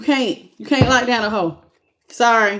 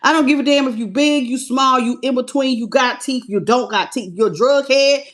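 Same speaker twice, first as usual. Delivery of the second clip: normal talking voice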